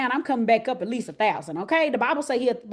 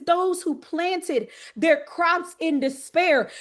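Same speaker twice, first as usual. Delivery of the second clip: slow preached scripture reading; 4 semitones higher, much slower than usual. Acted. Preacher reading the verse slowly to the women. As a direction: draw out each phrase of the verse, slowly.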